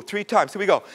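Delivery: ordinary delivery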